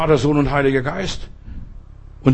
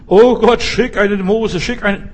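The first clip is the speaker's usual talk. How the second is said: hoch und laut flehend; flehender Schrei; 7 semitones higher, 5 dB louder than usual. Retold or imitated. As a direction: verzweifelt zu Gott schreien. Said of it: "sie schrien". (laut flehender Hilfeschrei)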